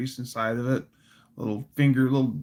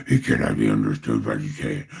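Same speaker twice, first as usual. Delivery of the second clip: much lower, hoarse breathy rasp; Batman growl; faster than usual. Shown as a demonstration. Showing how Bale talks in the suit, low growl. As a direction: grind the words out low and rough. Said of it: "he talks like this".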